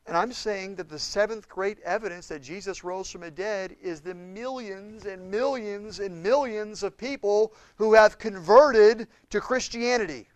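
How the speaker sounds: usual speaking voice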